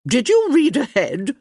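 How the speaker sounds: high, loud countess demanding an answer. A narrator's reading. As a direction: demand it sharply as an old countess. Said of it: "Lady D demanded".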